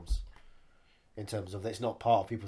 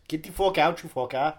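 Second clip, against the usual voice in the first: higher, louder put-on film-line delivery; Will Sasso voice; 5 semitones higher, 7 dB louder than usual. Quoting a film line to co-host, loud impression voice. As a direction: throw out the curse as a loud, raised impression. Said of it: "I'll do it in Will Sasso voice".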